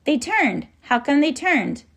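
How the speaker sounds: high, amazed exclamation; relieved cry. A narrator's reading. Ben sees the army turn away, relieved cry. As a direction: call out high with surprised relief. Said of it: "Ben cried out with relief".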